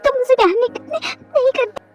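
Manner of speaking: high, crying plea; tearful pleading wife. Acted. Wife begging her husband, high and tearful. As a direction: beg him to say he loves her, near tears.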